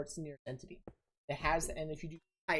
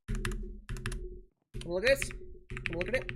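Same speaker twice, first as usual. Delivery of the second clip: drill sergeant; 2 semitones higher than usual. Real, not acted. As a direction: command it like a sergeant. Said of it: "I just sound like a drill sergeant".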